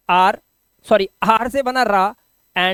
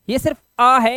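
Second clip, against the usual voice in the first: normal talking voice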